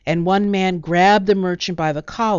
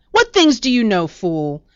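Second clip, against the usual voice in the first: angry taunt; 3 semitones higher, 5 dB louder than usual. A narrator's reading. Heated bickering in the market.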